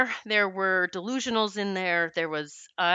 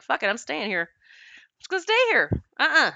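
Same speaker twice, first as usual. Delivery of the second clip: "Fuck it, I'm staying here" tossed off, then pitched up high and defiant; mock rebellious teen; 5 semitones higher, 4 dB louder than usual. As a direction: play the stubborn teen who won't leave. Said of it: "that's the rebellious Julie".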